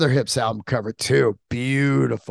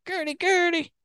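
very high, soft pet-calling voice; sweet high call to Gertie; calling the dog; 4 dB quieter than usual. Real, not acted. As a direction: coo the dog's name up high.

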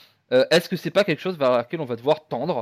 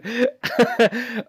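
short self-amused laugh; 8 semitones higher than usual. Real, not acted. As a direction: burst out laughing at yourself. Laughs after self-mockery.

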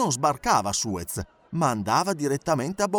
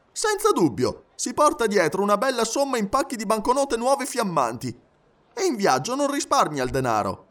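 high, eager valet's voice; animated high-pitched boasting; chatty Passepartout, impressed; 7 semitones higher than usual. Acted. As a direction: gush in a raised, lively pitch. Passepartout boasts of master's money.

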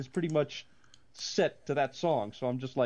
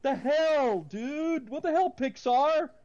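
exasperated outburst; 8 semitones higher, slower than usual. Retold or imitated. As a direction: vent at the movie in disbelief. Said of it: "and I'm like".

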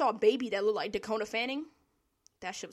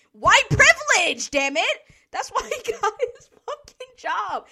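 high, loud, indignant outburst; real rant boiling over into a yell; exasperated shout; 10 semitones higher, 12 dB louder than usual. Real, not acted. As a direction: blurt it out as the real answer. Outburst about bad manager.

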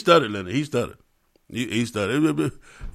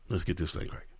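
much softer, lower repeat; hushed echo; 10 semitones lower, 16 dB quieter than usual. Shown as a demonstration. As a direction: drop to a hush on the echo.

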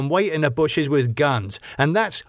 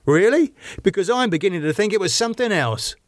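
sceptical challenge; 9 semitones higher, faster than usual. A narrator's reading. David challenges Elizabeth's answer.

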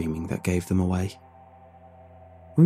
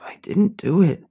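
breathless protest; pleading, gasping for air; out of breath; 9 semitones higher, 3 dB louder than usual. Acted. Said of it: "out of breath".

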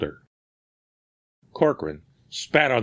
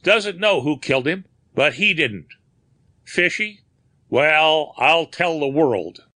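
scoffing; 7 semitones higher, 3 dB louder than usual. Acted. Corcoran mocks the story.

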